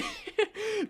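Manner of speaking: high, helpless laugh; burst of laughter. Real, not acted. Player caught in a trap, bursting out laughing. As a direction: crack up laughing in a high pitch.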